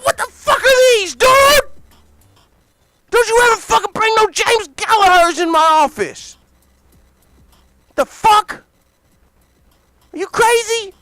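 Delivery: furious shout. Acted. [name] screaming at Scott over the headphones in a high furious yell. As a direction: scream at him like he insulted you.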